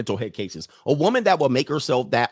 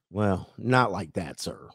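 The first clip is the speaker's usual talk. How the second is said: slow, lower, prissy objection; mock woman; 6 semitones lower, 4 dB quieter, slower than usual. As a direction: mimic women correcting him. Mocking women's objection.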